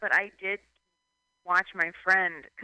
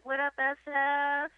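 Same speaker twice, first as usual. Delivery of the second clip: higher hype-man call; mock MC shout-out; 7 semitones higher, slower than usual. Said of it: "I would be like".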